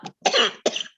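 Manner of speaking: sharp coughing fit from food down the wrong pipe; choking cough. Real, not acted. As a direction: cough it out hard. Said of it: "it went down the wrong pipe".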